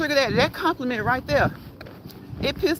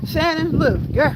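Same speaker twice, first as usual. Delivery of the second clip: laughing, higher and louder; 5 semitones higher, 4 dB louder, slower than usual. Real, not acted. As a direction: laugh through the name. Laughing aside to viewer.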